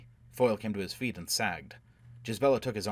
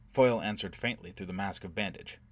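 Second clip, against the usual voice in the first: usual speaking voice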